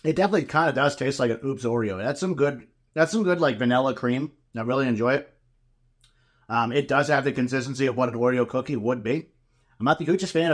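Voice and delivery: chewing between words; talking while chewing. Real, not acted. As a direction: mouth partly full of cookie. Seen 0: Holds bitten cookie at mouth, mouth working while speaking throughout.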